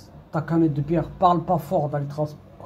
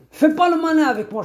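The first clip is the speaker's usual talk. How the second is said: sharp high warning, much louder; stern warning; 10 semitones higher, 5 dB louder than usual. Retold or imitated. Warning the man on the bus, loud and stern. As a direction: cut him down, loud and high.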